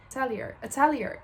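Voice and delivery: Atelier ending in "-lee-er", stressed on the middle; English pronunciation. Shown as a demonstration. Trying out English Atelier for viewers, English pronunciation. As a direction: say Atelier the English way, uh-TELL-yer. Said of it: "how you pronounce it in English".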